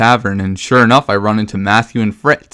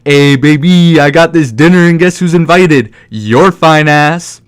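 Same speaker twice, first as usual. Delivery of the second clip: sleazy custodian; 4 semitones higher, 6 dB louder than usual. Retold or imitated. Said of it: "He's like".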